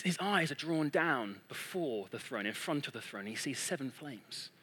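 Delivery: low, softened storytelling; quietly unfolding a vision; hushed awe. Retold or imitated. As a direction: bring it down to a reverent hush. Awed description of the vision.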